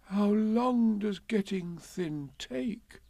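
high, slow, worried question; small anxious bear voice. A narrator's reading. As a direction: ask fretfully, drawing it out. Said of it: "asked Pooh anxiously".

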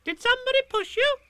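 put-on very high, loud little child voice; a tiny eager joey; Roo's voice. A narrator's reading. Roo asks Eeyore, very high and eager.

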